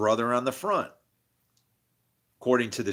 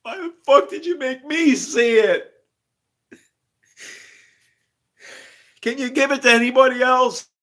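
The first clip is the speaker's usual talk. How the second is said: loud, much higher outburst; real exasperated yelling; frustrated yell; 12 semitones higher, 9 dB louder than usual. Real, not acted. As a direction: shout it at the sky in exasperation.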